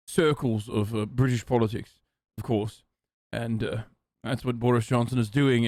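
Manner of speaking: low, hesitant British politician; mock British statesman, halting and low; Boris Johnson. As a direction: fumble through it with plenty of uhs. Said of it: "I'm secretly British like Boris".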